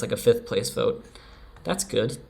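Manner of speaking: ordinary talk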